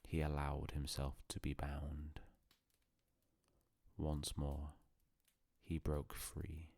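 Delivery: ordinary delivery, nothing marked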